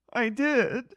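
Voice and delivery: high-pitched mimicking reply; Raquel. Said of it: "and Raquel going".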